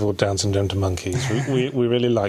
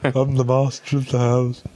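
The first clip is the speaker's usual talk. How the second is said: pretend child at play. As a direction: play hide and seek in a silly kid voice. Playing house inside a big box.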